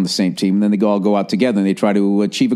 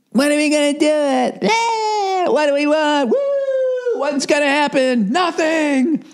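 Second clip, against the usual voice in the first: high, shrill-free chanting crowd call and response; mock protest crowd; slower than usual. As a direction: ham up a rally crowd chanting.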